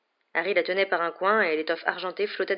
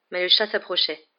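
ordinary delivery, nothing marked

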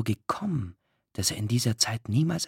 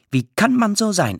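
high, loud, indignant question; raised indignant cry; agitated outburst; 9 semitones higher, 6 dB louder than usual. A narrator's reading. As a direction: flare up in protest. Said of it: "erregte sich Rothschild".

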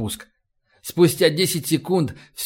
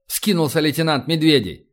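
ordinary delivery

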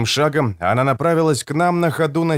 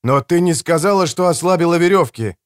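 higher, forceful angry accusation; raging; 6 semitones higher, 4 dB louder than usual. Acted. Narrator rages at the woman over ropes, raging. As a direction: cut in, raging, pitched up. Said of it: "бушевал я".

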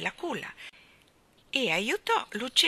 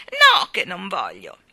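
loud, drawn-out refusal; defiant shout; 12 dB louder, slower than usual. A narrator's reading. Starling pulls away from Mary Poppins, defiant shout. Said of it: "egli gridò".

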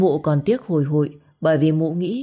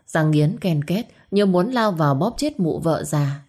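usual speaking voice

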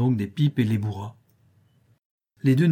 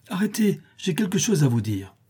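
higher call after friends; 7 semitones higher than usual. Acted. Tom running after his departing friends, calling out. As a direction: shout after them to stop. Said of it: "en criant".

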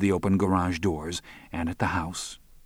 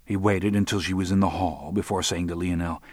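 plain reading voice

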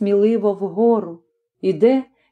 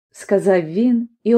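usual speaking voice

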